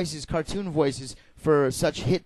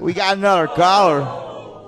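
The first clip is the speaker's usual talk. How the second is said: announcer voice; 5 dB louder, slower than usual. Acted. Radio host calls out.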